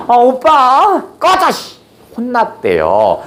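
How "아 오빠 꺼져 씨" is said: high, sharp cry of annoyance from the king; angry brush-off. Acted. The king rejecting the fawning lady, snapping angrily. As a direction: push her away in disgust.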